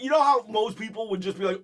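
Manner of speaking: usual speaking voice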